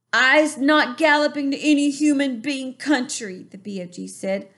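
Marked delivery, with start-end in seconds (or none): 0.1-3.5 higher, louder, politely firm giant; calm giant, higher pitch. A narrator's reading. BFG asking the bully to let go, politely firm.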